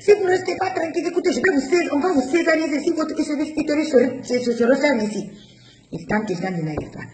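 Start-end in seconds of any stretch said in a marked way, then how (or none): 0.0-5.3 much higher, fast mock shouting; imitating the yelling doctor. Doctor threatening the mother with a caesarean, fast mock shouting. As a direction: bark threats at the patient, rushing. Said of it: "on me crie dessus".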